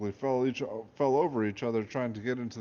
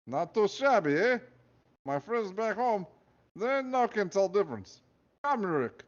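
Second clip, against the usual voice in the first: high, proud Russian guard; Russian accent; 10 semitones higher than usual. Acted. Nikolai pleased with himself.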